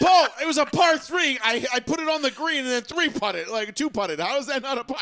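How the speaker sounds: high, loud re-enacted argument; indignant protest. Golfer defending his par to Paul, indignant. Retold argument over a golf score.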